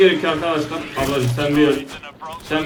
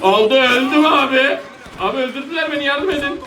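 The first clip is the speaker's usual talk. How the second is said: high, urgent calls climbing higher; panicked plea; 8 semitones higher than usual. Real, not acted. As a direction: cry for help as you go down in a game. Dying in game, calls for help. (panik içinde tiz sesle yardım isteme)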